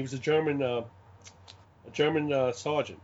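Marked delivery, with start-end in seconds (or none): none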